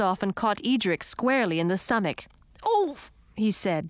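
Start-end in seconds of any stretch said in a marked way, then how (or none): 2.6-3.0 short, high grunt on impact; pained "oof". A narrator's reading. Frog prince kicked in the stomach, winded grunt. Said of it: "doubling over".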